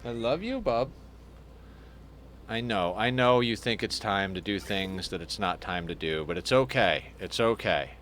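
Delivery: gentle reassurance to the hamster; soothing pet talk. Real, not acted. Owner comforting the hamster that wants out: soft soothing talk.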